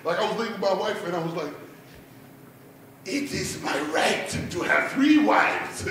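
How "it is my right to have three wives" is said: slow, put-on Wakandan royal voice; Wakandan mannerisms. Husband leaving his wife, grandly Wakandan. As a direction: declare it slowly, as a mock Wakandan. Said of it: "my whole mannerisms would change".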